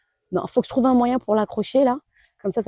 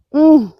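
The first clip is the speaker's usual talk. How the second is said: approving mmh of taste; loud yummy hum; 6 semitones higher, 8 dB louder than usual. Real, not acted. Eating a strawberry.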